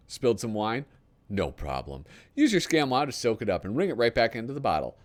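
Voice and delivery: lightly hammed-up TV pitchman read; infomercial read. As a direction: sell it like a late-night ad. Reads a parody TV ad.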